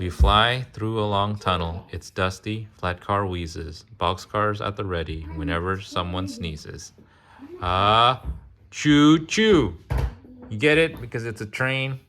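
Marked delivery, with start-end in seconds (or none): 0.0-6.9 sing-song picture book reading; reading to children. Acted. Parent reading a train picture book to a child, sing-song. 7.6-9.8 loud, high hooting choo choo; train whistle call. Pretend. Parent hooting like a train for the child, loud and high.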